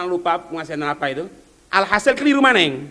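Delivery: ordinary talk, nothing marked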